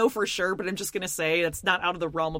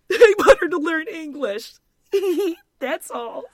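put-on higher, louder, giggling like a little boy; giggly little boy; 9 semitones higher, 7 dB louder than usual. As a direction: imitate Mike's bashful giggle. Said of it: "does this, like, little boy giggle".

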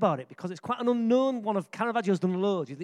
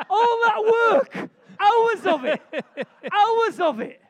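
high, loud, slow wail; mock lament; 12 semitones higher, 8 dB louder, slower than usual. Mock lament over lost recruit.

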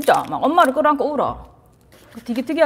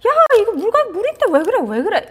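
high, impatient mom brushing it off; impatient mother scolding; 10 semitones higher than usual. Retold or imitated. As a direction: dismiss the child's fear sharply. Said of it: "엄마들 이렇게 말하는 사람 있어요".

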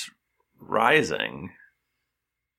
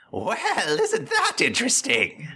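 high eerie disembodied voice; 10 semitones higher than usual. Acted. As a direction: play it as a spooky unseen presence. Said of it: "a disembodied voice say".

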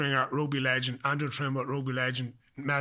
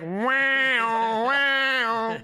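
high, loud, drawn-out amazed wow; retold stunned wow; 9 semitones higher, 6 dB louder than usual. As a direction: blown away, reliving the shock of arrival. Stunned on arriving in camp.

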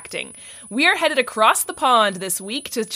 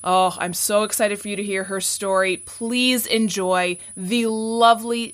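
ordinary talk

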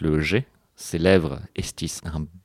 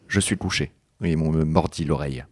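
ordinary talk, nothing marked

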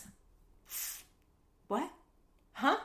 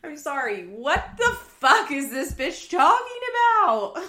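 loud incredulous outburst, pitch shooting up; outraged disbelief; 7 semitones higher, 9 dB louder than usual. Real, not acted. Podcaster reacting to Amber's claim, loud outrage. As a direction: yell it in disbelief at the screen.

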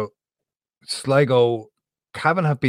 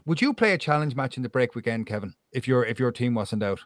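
usual speaking voice

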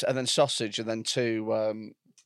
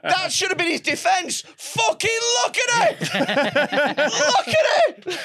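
high, loud laughing yell; laughing shout; 14 semitones higher, 4 dB louder than usual. Real, not acted.

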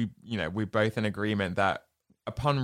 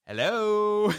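sly hello; 11 semitones higher, 3 dB louder than usual. Retold or imitated. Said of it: "Woody pops out".

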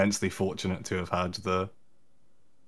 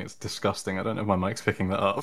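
normal talking voice

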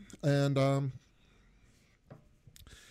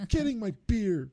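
gruff impatient scolding; 10 semitones higher, 3 dB louder than usual. Retold or imitated. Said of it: "The Landlord Pearl".

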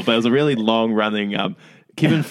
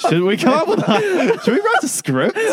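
much higher, laughing through the words; cracking up at your own running joke with the co-host; 3 dB louder than usual. Real, not acted. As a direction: giggle out the question, slower. Laughing at their usual plan.

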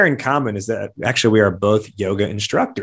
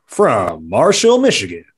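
boxing ring announcer; 3 semitones higher, 3 dB louder, slower than usual. Acted. Ring-style guest introduction.

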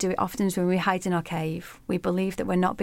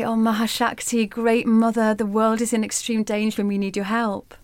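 raised, higher pleading to the goddess; pleading cry; 4 semitones higher, 4 dB louder than usual. Acted. Sages and gods beg Durga for help, raised pleading voice. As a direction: beg loudly for help, hands clasped in voice.

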